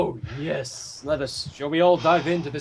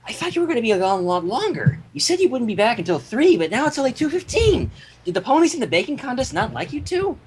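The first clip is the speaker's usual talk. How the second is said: higher, bubbly cartoon pony voice; Pinkie Pie voice; 6 semitones higher than usual. Pinkie greeting the Cakes, higher bubbly pony voice. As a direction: chirp it like an eager pink pony. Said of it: "get my Pinkie Pie voice".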